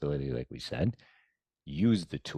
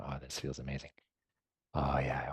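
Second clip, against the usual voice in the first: soft inner delight; 2 semitones lower, 6 dB quieter than usual. Retold or imitated. Inner voice enjoying meditation.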